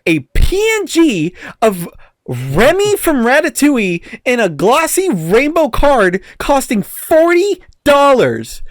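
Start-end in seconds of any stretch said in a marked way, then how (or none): 2.3-8.6 slow, high, stretched-out forty dollars; outraged disbelief. Real, not acted. Complaining to a friend about a card price, slow outrage. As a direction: drag it out in disbelief.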